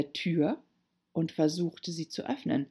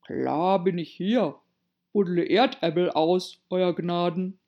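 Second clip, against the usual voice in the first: Pat the servant; 4 dB louder than usual. A narrator's reading. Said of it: "eine Stimme, die sie vorher noch nie gehört".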